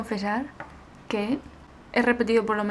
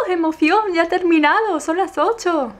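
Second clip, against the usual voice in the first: higher, louder happy shouts; over-the-top cheering; exaggerated excitement; 7 semitones higher, 6 dB louder than usual. Real, not acted. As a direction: burst out with glee. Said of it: "estoy exagerando, es como, ¡wow!".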